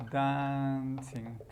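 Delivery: "dancing" stretched long, first syllable held level; drawn out. Shown as a demonstration. Teacher shows the gerund of dance, drawn out. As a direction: say "dancing" very slowly for learners. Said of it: "Y dance sería".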